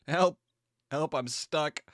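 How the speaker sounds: stuck lizard calling for help. Acted. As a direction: playfully call for help as a trapped lizard. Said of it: "That's a lizard saying".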